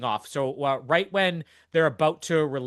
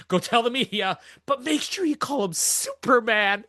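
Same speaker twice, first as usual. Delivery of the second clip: laughing; 6 semitones higher than usual. Retold or imitated. Retold Superman quip, laughing.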